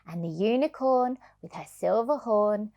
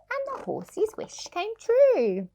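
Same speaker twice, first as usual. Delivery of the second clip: much higher and slow, floating up; magical sing-song; 12 semitones higher than usual. Acted. Reader to child, sing-song magic moment. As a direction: let the magic rise.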